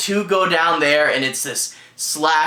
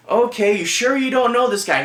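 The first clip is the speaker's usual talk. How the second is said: higher-pitched mock officer humoring a caller; unhelpful police; 7 semitones higher than usual. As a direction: imitate a dismissive cop. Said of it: "just being super unhelpful".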